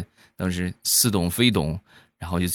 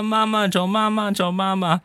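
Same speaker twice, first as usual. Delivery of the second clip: high, sustained bawl for mum; mock child's wail; 13 semitones higher than usual. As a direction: cry out like a hard-done-by kid, hammed up. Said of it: "一般小朋友都是受了委屈啊".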